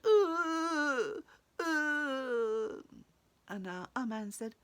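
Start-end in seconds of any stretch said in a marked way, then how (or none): 0.0-2.8 loud, drawn-out agonised groan; long pained moans; groaning tree. Acted. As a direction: moan loudly like a tree in pain. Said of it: "spindly tree that went".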